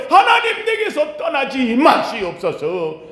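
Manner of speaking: very high call, slowing and falling; desperate prayer cry. Acted. Seeker begging God not to leave, desperate high cry.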